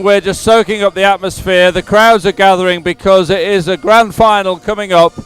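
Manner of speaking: normal talking voice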